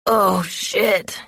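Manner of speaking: drawn-out, understated moan; pained moan. A narrator's reading. Injured man curled up on the mat, pained moan. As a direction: moan it slowly through the pain. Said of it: "he moans".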